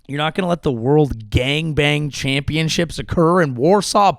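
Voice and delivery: ordinary delivery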